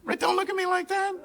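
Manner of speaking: high, indignant protest to the crowd; mock defensive. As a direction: protest as if wrongly judged. Mock-defensive aside to laughing audience.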